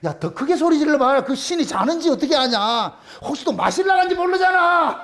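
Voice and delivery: laughing mockery of the false prophets; mocking taunt. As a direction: taunt them with a chuckle. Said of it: "엘리야가 옆에서 조롱하잖아요".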